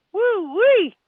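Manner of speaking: excited whoop. Real, not acted. Reacting to 101 degree heat.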